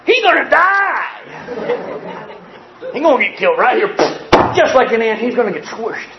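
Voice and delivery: a high whooping schoolyard yell that settles down; kids yelling. Retold or imitated. Kids cheering on the lunchroom fight, yelling. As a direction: shout it like a crowd of excited kids. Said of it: "everybody's going".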